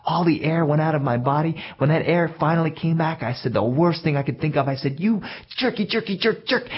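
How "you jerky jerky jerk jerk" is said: higher, mock kid name-calling; childish insult. Winded boy insulting the bully, childish. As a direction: sputter the worst insult a kid knows.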